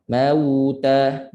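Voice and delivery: drawn-out "mauta" with no break before ta; flowing voice. Shown as a demonstration. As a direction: carry the sound smoothly through "mauta". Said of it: "Suara mengalir". ("mauta" suara mengalir)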